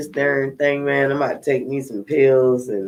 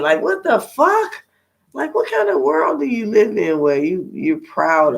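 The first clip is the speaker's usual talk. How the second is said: much higher, stunned what the fuck; outraged disbelief; 10 semitones higher than usual. Real, not acted. Venting to viewers about a man exposing himself, high outraged disbelief. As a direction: climb high in shocked outrage.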